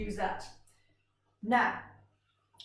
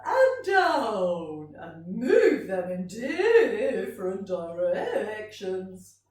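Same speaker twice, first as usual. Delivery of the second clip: stretching the voice; 7 dB louder, much slower than usual. Shown as a demonstration. Teacher showing children how voices can stretch, swooping up and down. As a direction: swoop up on UP, glide down on DOWN. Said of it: "We can stretch our voices".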